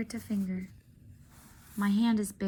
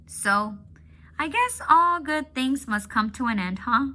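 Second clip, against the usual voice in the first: Bob, lightly voiced, hushed then brighter and louder; 4 semitones higher, 7 dB louder than usual. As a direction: put on a bright face over the sadness. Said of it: "His voice is bright".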